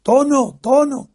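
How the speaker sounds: name yelled out high and loud across the room; shouting a name. Retold or imitated. Friend spotting him in the hotel, yelling his name. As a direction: call the name loudly to catch his attention. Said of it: "a voz en grito".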